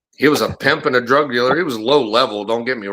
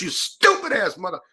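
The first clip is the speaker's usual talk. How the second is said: higher, held-back mock yell; retold angry cursing; 7 semitones higher, 4 dB quieter than usual. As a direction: imitate someone cursing behind a slammed door. Overheard cursing behind slammed door.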